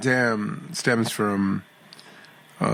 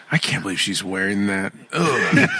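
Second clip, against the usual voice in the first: catty judgmental gossip; 4 semitones lower than usual. Retold or imitated. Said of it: "Here's a go-to trick that guys use".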